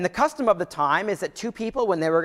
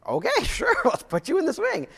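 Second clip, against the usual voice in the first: laughing, pitched up high; amused dad; 13 semitones higher than usual. Retold or imitated. Dad laughs agreeing to the swing, high and amused. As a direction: chuckle through an easy yes. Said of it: "I'm like".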